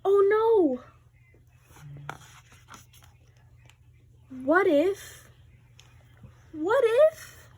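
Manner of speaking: worried. Acted. Gerald panics about sneezing.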